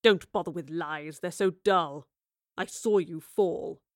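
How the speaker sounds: low man's voice, dismissive; cool bored villain. Acted. Man catching the girl out in a lie, bored disdain. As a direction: brush the lies aside, low and unimpressed.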